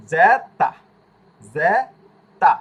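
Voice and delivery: জেতা with a slight stop before তা; Italian zeta, held and released. Shown as a demonstration. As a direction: slowly say জেতা, pausing then releasing. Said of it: "জেতা থামবে আবার ছাড়বে".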